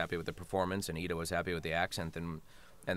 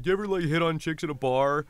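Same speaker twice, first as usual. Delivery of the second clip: mock-eager question, pitched up; eager questioner; 8 semitones higher, 7 dB louder than usual. Fans asking the actor about bar pickups, mock eager. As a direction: imitate eager fans asking, higher and louder. Retelling fans' nosy question.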